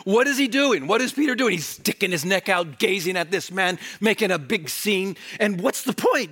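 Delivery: usual speaking voice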